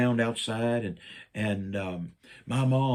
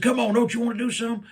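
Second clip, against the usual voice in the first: eager mom urging; 11 semitones higher, 4 dB louder, much faster than usual. Retold or imitated. Mom urging son to paint.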